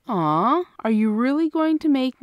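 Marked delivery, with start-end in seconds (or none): none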